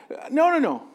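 usual speaking voice

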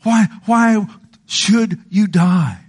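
high, slow, drawn-out plea; pleading as God. Retold or imitated. God asking the wicked to turn, high slow plea. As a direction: implore with long stretched words. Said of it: "listen to what God says".

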